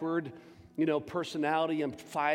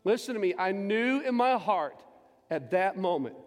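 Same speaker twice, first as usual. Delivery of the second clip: raised, loud preacher's cry; preaching shout; 5 semitones higher, 4 dB louder than usual. Real, not acted. Preacher telling the church, in a loud raised cry. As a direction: belt out the conviction from the heart.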